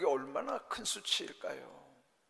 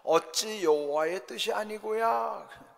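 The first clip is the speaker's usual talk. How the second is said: higher, louder, drawn-out taunt; retold mockery; 6 semitones higher, 6 dB louder, slower than usual. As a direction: quote the enemy's challenge with raised, slow scorn. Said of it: "조롱하면서". (조롱하듯 높고 크게 늘여 되묻기)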